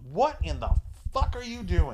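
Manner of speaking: acted disbelief, pitch jumping up on the curse; mock indignant outburst. Pregnant girl catching him singing, mock outraged. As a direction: break it up with a pause before the curse, then go high.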